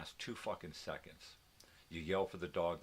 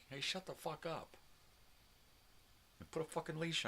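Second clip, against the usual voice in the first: mock yell, pitched higher; imitating his yell; 10 semitones higher than usual. Telling the screaming neighbor off, mock yell. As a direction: throw back what you'd yell at him. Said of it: "Guy runs around screaming".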